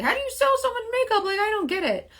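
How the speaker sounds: high-pitched imitation of baffled askers; squeaky-high mock bewilderment; puzzled customers. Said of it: "a lot of people ask me".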